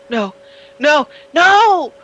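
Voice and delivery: high, loud cry of refusal, building; horrified scream. Acted. Game master voices Daniel's horror as he changes, screaming. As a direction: scream out in horror as the body transforms. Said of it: "just starts screaming".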